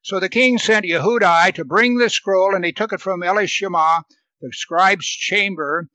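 preacher reading scripture aloud. Acted. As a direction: read the passage out to the congregation.

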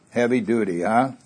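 usual speaking voice

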